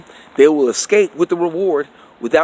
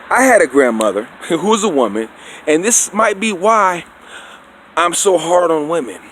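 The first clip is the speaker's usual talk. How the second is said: ordinary talk, nothing marked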